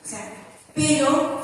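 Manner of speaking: ordinary talk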